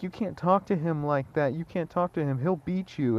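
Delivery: a hint of a snotty classmate warning; mock snooty girl. Mimicking the girl's warning.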